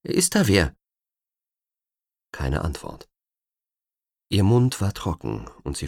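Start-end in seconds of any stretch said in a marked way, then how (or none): 0.0-0.8 timid call. Acted. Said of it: "rief sie zaghaft".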